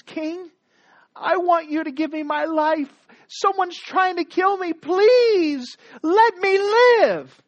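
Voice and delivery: high, pleading voice rising to a slow loud cry; pleading queen. Acted. Esther begging the king to spare her life, high pleading. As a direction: beg the king for your life. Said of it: "And she goes and says".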